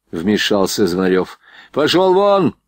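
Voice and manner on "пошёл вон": high, threatening yell; irate order, pitch raised throughout; angry shout. A narrator's reading. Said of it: "крикнул Борейко".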